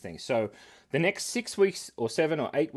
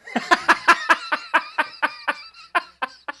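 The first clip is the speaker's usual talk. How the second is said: a long high burst of laughter; shrieking laughter, high and long; big laugh; 9 dB louder than usual. Real, not acted. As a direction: laugh out loud at a comment.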